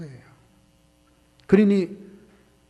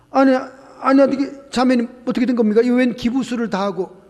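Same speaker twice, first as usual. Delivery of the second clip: shocked exclamation; 5 semitones higher, 7 dB louder than usual. Retold or imitated. Pastor shocked at the cast.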